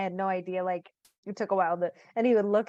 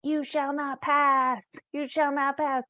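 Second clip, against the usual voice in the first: higher, drawn-out mock wizard proclamation; wizard clock; 6 semitones higher, slower than usual. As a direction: intone it slowly like a talking toy wizard. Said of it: "in this exact tone of this, like, wizard clock".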